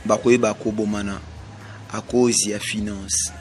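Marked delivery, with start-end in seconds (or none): none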